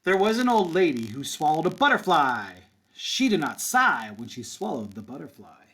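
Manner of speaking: lively singsong read-aloud for kindergarten; picture book storytime voice; reading to children. Acted. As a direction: read it with a bouncy rhythm for little kids. Teacher reads picture book aloud.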